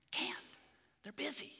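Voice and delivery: put-on soft little-boy whisper; whispering child. Jimmy whispering to the pastor that the firemen are busy. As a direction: hush it like hiding. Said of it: "a voice answered with a whisper".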